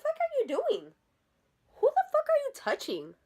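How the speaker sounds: very high-pitched indignant outburst; outraged confrontation. Retold or imitated. Teller confronting woman who grabbed her sister, outraged. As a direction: squeal it in protective outrage.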